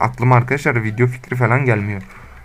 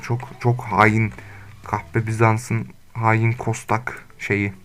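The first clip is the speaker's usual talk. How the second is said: very slow, softer mock grief; mock dramatic lament; 4 dB quieter, much slower than usual. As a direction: drag each word out like a tragic curse. Dramatic lament over dead wolves. (ağır ağır abartılı yakınma)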